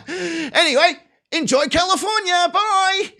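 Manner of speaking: very high sing-out with a laugh; mock cheery send-off. Prosecution dismisses the man it robbed, gleefully. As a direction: wave them off with breezy false cheer.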